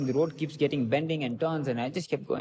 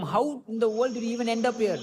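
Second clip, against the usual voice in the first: mock outrage; 7 semitones higher than usual. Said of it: "he said".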